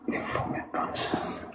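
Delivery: drawn-out voiceless mutter, slow; slow whispered aside; slow whispered insult. Real, not acted. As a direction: let it hiss out slowly under your breath.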